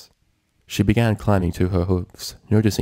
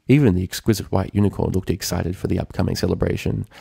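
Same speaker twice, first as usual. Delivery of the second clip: usual speaking voice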